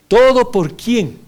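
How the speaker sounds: slow, high, loud rhetorical call; preaching question. Acted. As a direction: call it out to the congregation. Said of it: "volvamos al inicio de la lección".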